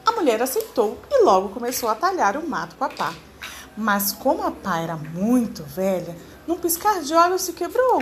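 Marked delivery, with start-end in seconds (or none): none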